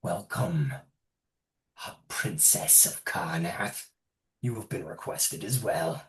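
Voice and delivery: low, soft, grave shaman voice. Acted. Shaman greeting Ludwig on the Lesh's behalf, low and grave. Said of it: "And looks at Edith and says".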